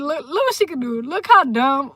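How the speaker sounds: ordinary talk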